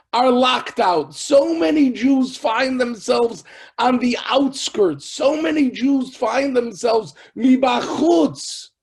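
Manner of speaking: high, loud, emphatic sermon voice; impassioned preaching. Retold or imitated. Baal Shem explaining to a left-out disciple, impassioned preaching. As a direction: proclaim it at full force, phrase by phrase.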